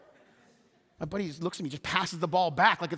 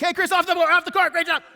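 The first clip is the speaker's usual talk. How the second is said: coach's voice; 10 semitones higher, 8 dB louder than usual. Retold or imitated. Coach pulls him off.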